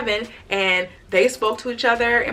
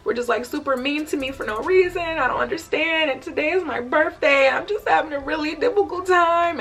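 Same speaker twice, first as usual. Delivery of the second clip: a hint of tearful pleading, higher; tearful complaint; 7 semitones higher than usual. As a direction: complain like you're about to cry. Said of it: "I'm just, like, crying".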